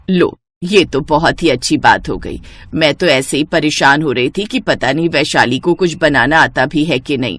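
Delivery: mother-in-law voice. Acted.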